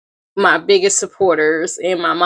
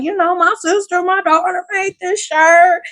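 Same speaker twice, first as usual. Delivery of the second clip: higher, bragging voice; proud relative; 10 semitones higher than usual. Retold or imitated. Relatives bragging about her, higher and proud. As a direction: show off the maker proudly. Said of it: "And like".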